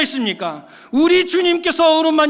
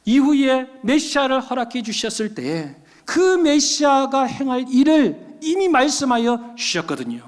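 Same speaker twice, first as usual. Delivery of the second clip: ordinary delivery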